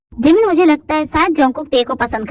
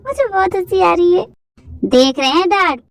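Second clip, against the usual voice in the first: ordinary talk, nothing marked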